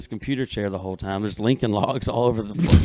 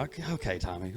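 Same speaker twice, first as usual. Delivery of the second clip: very soft, dismissive retold reply; acted placating; 3 semitones higher, 14 dB quieter, faster than usual. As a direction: humor him under your breath. Acts placating reply to boy's threat.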